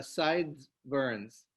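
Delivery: ordinary delivery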